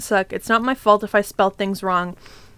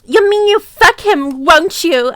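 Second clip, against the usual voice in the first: high, loud jealous shout; angry shout; 5 semitones higher, 4 dB louder than usual. Acted. Narrator shouting at Vampire in jealousy. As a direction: fling it at him, higher and louder. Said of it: "I shouted angrily".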